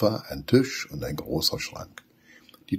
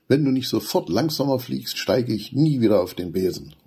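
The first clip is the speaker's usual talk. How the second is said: higher, a touch louder indignant threat; angry call; 6 semitones higher, 3 dB louder than usual. A narrator's reading. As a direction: threaten her, fed up. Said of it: "ruft Benny erbost". (erbost rufend)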